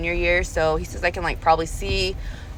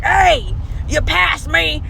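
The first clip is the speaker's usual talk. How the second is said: louder and higher, irritated complaint; annoyed caller; 9 semitones higher, 3 dB louder, much slower than usual. Retold or imitated. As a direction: snap at the driver for missing him. Annoyed rider calls the driver.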